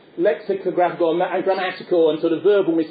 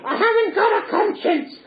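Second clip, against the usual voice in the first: much higher gleeful puppet cry; Punch squawk. Retold or imitated. Punch boasting to the crowd, high puppet squawk. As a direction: crow it like a wicked puppet.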